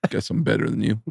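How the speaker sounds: low, soft smug deadpan; understated braggart; Judah Friedlander. Retold or imitated. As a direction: lightly imitate a casual one-upper. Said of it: "And Judah goes".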